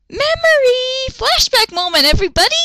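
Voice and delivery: high, loud showman call; dramatic announcement. Acted. Host announcing the flashback to viewers, loud and high.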